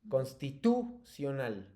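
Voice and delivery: "constitucional" with TU punched, then falling; "constitucional" said as constiTÚcional, slow and measured; stress on TU. Shown as a demonstration. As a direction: slowly stretch the TU in "constitucional". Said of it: "te las voy a pronunciar todas con el acento fuerte".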